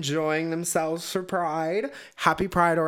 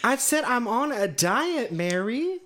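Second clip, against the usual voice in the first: mock indignant; 9 semitones higher, 4 dB louder, slower than usual. Joking retort to friend.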